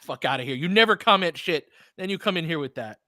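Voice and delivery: normal talking voice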